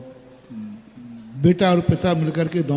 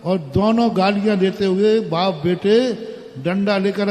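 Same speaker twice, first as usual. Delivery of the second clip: ordinary delivery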